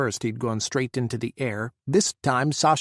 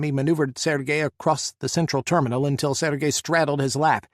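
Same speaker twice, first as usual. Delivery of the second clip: usual speaking voice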